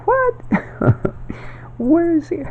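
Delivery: mock baffled listener, squeaky high; confused listener. Mocking someone who can't follow the speaker, high and baffled. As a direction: play a puzzled outsider pitching way up. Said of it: "I switch to this other way and going".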